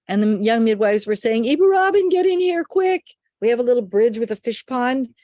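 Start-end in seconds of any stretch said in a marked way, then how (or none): 1.4-3.0 high, loud call across the yard; urgent call. Retold or imitated. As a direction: imitate midwives summoning help urgently. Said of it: "I heard screaming".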